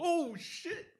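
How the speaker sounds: very high-pitched, re-enacted astonished squeal; losing it. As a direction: relive the shock, voice shooting way up. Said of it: "I lost my mind".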